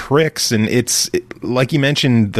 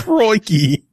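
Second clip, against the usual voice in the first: higher hammed-up Australian crikey; mock Australian; 9 semitones higher than usual. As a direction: throw out a cartoon Australian crikey. Echoes the accent joke.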